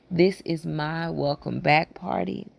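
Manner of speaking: usual speaking voice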